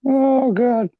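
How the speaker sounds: high-pitched oh God; exasperated. Real, not acted. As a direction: squeal it out in disbelief. Reaction to business meeting joke.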